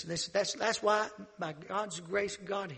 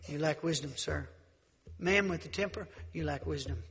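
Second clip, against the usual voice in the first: ordinary talk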